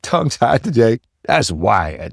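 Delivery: talking with tight retainers in. Real, not acted. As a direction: speak with a retainer in your mouth. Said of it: "if I sound a little tongue-tied today".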